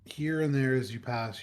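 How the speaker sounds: ordinary delivery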